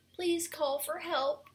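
high, slow storybook plea; begging. A narrator's reading. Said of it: "he begged".

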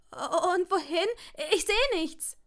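high, loud, helpless wail; panicked cry. A narrator's reading. Longbottom blind under the cloak, panicking.

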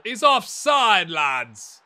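loud, high, stretched-out protest; shouted offside appeal. Real, not acted. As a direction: call out to the lads, loud and drawn out. Shouts offside complaint at the match.

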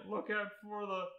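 high-pitched repeated warning; urgent warning. A narrator's reading. Orange ninja warns of mailman, high and urgent. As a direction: raise the pitch in alarm.

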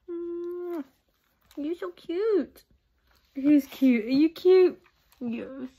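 high cooing pet voice. Real, not acted. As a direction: coo at the puppy. Talking to her dog.

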